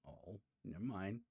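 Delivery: usual speaking voice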